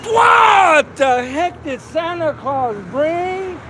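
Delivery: slow, high, loud shout; excited shout. Real, not acted. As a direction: call it out for the big reveal. Reveal shouted at the group.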